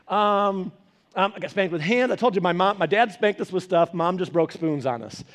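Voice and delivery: normal talking voice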